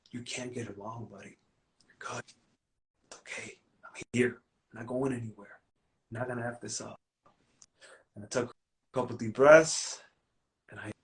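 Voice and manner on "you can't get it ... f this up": soothing. Retold or imitated. Comforting his inner child Roy.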